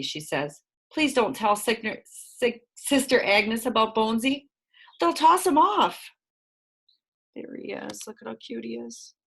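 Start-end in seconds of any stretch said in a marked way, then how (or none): 0.9-6.1 Josie pleading, higher, slowing at the end; a lightly voiced pleading girl. A narrator's reading. 7.3-9.1 soft, hushed cooing over a kitten; softer tender aside; cooing softly. A narrator's reading.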